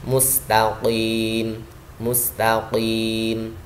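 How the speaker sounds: "Mustaqim" very slow, heavy q, long final vowel; heavy qaf. Shown as a demonstration. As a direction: raise the tongue back on the q of "Mustaqim". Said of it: "lidahnya diangkat". ("Mustaqim" panjang dengan qaf tebal)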